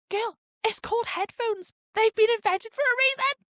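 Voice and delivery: high-pitched, indignant telling-off; exasperated scolding. Retold or imitated. Passenger to people blasting phones, exasperated scolding. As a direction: scold them in high mock outrage.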